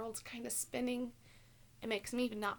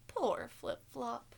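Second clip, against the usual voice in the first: ordinary delivery, nothing marked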